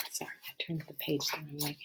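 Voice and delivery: quick soft unvoiced whisper; hurried apology under the breath; whispered aside. Real, not acted. Aside after page mistake.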